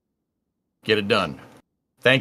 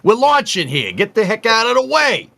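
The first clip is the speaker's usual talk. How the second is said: put-on higher, brash New York accent; 9 semitones higher than usual. Host reads a viewer's request, yelling at boats in a New York accent. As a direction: bark it like a New York cabbie clearing the road. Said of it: "in a New York accent".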